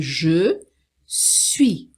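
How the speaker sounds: je suis split in two by a pause; full je, then suis set apart; formal French pronunciation. Shown as a demonstration. Teacher explaining how to write it, formal je suis. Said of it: "comunicação mais formal".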